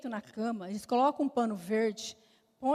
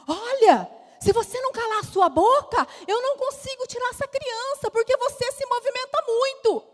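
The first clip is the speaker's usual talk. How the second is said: high, loud scolding rant; 12 semitones higher, 6 dB louder than usual. Retold or imitated. Doctor scolds the trembling patient, high and loud. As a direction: tell her off sharply.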